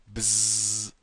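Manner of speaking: loud buzzing fly noise. Pretend. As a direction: buzz like a housefly. Imitating a fly.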